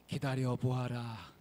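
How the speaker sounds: mountain god. Acted. Mountain god telling the woodcutter to wait, slow and low. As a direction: draw out the command like a solemn elder.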